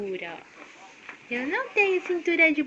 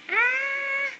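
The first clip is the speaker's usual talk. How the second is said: high, sustained "ah"; high held cry to coax a scream; demonstrated scream; 12 semitones higher than usual. Shown as a demonstration. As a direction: model a short, controlled high scream.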